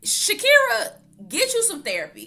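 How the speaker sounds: high, loud call. Real, not acted. Telling [name] to get therapy, loud high call-out. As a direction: call her out across the room. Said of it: "calling out to her".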